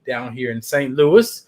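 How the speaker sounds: ordinary delivery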